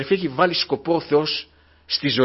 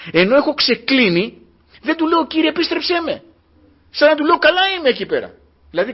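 higher, louder impassioned preaching; fiery preaching; 3 semitones higher, 6 dB louder than usual. Acted. Preacher rebuking the congregation for staying fallen, louder and higher. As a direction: drive the rebuke home from the pulpit.

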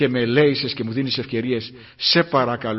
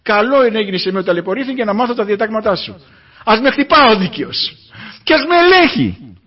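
fervent prayer; 6 semitones higher, 7 dB louder than usual. Acted. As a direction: cry it out to God, building. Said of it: "Θα πεις". (προσευχή προς τον Κύριο, αργά και δυνατά, κλιμακωτά)

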